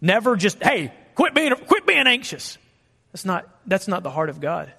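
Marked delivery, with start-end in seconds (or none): 0.6-2.6 much higher, impatient mock scold. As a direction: nag them to stop worrying. Contrasting harsh voice with God.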